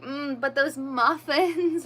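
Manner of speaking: slow, longing mmm; drawn out with a craving; craving muffins. Retold or imitated. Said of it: "today I was like".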